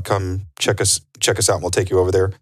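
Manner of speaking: ordinary delivery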